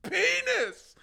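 high-pitched comic exclamation; mock blurted call. Mimicking men hitting up your phone, mock high blurt. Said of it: "hitting your phone up and being like".

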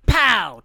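loud, high shouted "pow"; gunshot "pow". Retold or imitated. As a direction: fire off a loud vocal gunshot. Imitates a gunshot in story.

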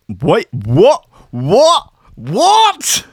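mounting shock, going up with every what; building disbelief. Real, not acted. Streamer reading a headline in rising disbelief.

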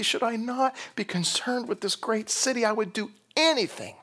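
high, straining tearful plea; acted crying plea. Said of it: "Jesus is crying over this city".

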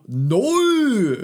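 high, loud, drawn-out northern English "No."; northern English accent. Retold or imitated. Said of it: "amazing way of saying no".

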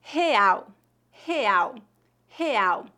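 "real" as HE-AU, the R breathed in the throat like an H and the final L like U; Portuguese pronunciation. Shown as a demonstration. Teacher models the Portuguese word for learners to repeat, throaty Portuguese R. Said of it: "a letra R ... é pronunciada na garganta".

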